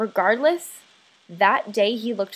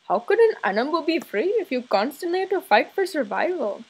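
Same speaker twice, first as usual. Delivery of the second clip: father's put-on voice, higher and lilting; imitating father; 5 semitones higher than usual. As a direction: quote your dad in his swooping voice. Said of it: "a sentence I heard him say".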